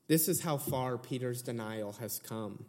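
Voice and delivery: ordinary talk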